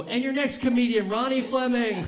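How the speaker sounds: loud, high announcer call; host announcement. Retold or imitated. Club host announcing the next comic, loud high announcer call. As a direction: boom it out like a club host introducing the act.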